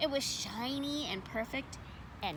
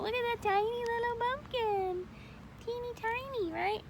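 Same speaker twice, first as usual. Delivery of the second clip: cute; 11 semitones higher, 3 dB quieter than usual. A narrator's reading. Marvelling at tiny pumpkin.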